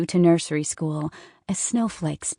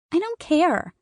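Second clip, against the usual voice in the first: put-on much higher, louder, a stubborn child's retort; defiant little girl; 11 semitones higher, 4 dB louder than usual. A narrator's reading. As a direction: snap it back like a defiant three-year-old. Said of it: "Sophie retorted".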